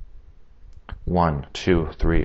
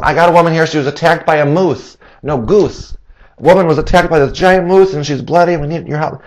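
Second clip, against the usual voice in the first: lightly voiced, higher, hurried report; pastor on the phone; 6 semitones higher than usual. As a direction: blurt it out to the dispatcher.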